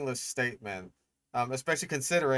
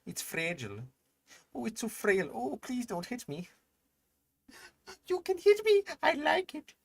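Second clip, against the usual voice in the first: mock frail creature; 10 semitones higher than usual. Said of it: "Fragilis is just like".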